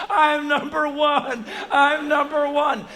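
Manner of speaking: slow, high, loud victory chant; crowing little boy. Retold or imitated. Eight-year-old king of the hill, triumphant chant. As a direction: crow it like a child champion.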